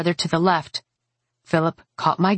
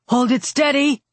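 higher-pitched, carrying command; calling out an order; 8 semitones higher than usual. A narrator's reading. Mage keeping students in position as enemy nears, raised command. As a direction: call to the line to hold.

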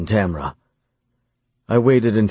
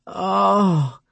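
high cry without added volume; screamed; 12 semitones higher than usual. A narrator's reading. Lover overcome as he watches, mild high scream. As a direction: draw out a high, restrained mock scream. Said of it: "she screamed".

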